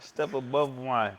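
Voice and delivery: drawn-out, falling echo; slow repeat. Real, not acted. Echoing the other guest's claim, slow and deliberate. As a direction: repeat it slowly, letting the end drop.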